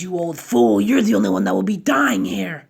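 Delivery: loud defiant character voice; defiant old man. Acted. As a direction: throw the threat back at him. Hiruzen defies Orochimaru.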